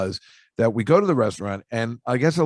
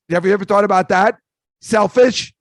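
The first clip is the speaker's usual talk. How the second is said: mock scolding shout; 7 semitones higher, 5 dB louder than usual. Ranting at people dining alone.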